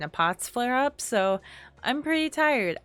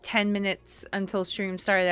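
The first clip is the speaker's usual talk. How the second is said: ordinary talk, nothing marked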